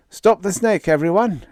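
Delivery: high, loud command; rally everyone with a yell. Acted. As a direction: Brunet yelling orders at the crew. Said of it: "yelled Brunet".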